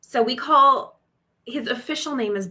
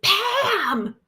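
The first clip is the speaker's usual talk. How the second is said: high, punchy exclamation; mock screaming text; 10 semitones higher than usual. Imitating random text messages, mock screaming. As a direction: pop it out like an all-caps text.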